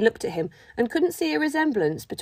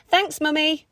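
shouting back; 6 semitones higher, 3 dB louder, slower than usual. Acted. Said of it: "Reuben shouted back".

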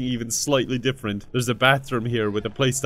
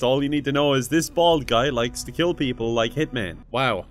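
usual speaking voice

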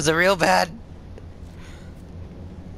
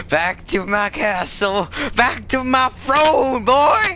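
slow, rising higher and louder; mock-grand proclamation; 8 semitones higher, 4 dB louder, slower than usual. Gamer retreating to his castle, mock-grand proclamation. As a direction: build to a hammed-up royal cry.

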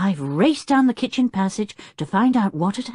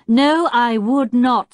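slow, louder firm refusal; firmly; 7 semitones higher, 7 dB louder, slower than usual. A narrator's reading. Julian firmly refusing Tinker's plane noise. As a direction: shut the idea down flatly. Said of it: "said Julian firmly".

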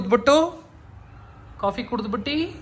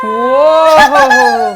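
loud, delighted "ho, ho"; wowed; 5 semitones higher, 12 dB louder than usual. Real, not acted. Husband reacting to his surprise gift, loudly wowed. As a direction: whoop at the surprise.

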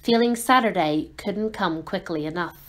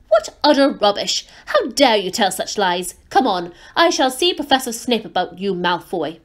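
angry scolding; 4 dB louder than usual. Acted. McGonagall scolding Malfoy.

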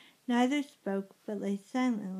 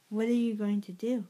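ordinary delivery

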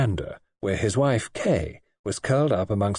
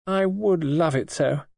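wistful; 7 semitones higher, slower than usual. A narrator's reading. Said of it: "and added wistfully".

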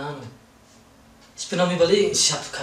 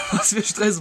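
laughing as he answers; 6 semitones higher, slower than usual. Real, not acted. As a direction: laugh through the words. Laughing reply to question.

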